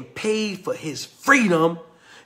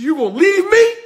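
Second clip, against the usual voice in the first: much higher, louder, a hurt cry; pleading; 12 semitones higher, 7 dB louder than usual. Retold or imitated. Rose begging Kunta Kinte not to leave her, high and hurt. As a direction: beg him not to go.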